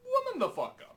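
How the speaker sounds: squeaky mock scold. Topping the joke, mock scold.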